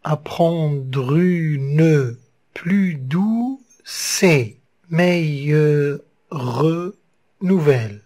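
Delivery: very slow, syllables pulled apart; slow syllable-count reading. Shown as a demonstration. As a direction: scan the verse slowly beat by beat. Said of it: "douze syllabes".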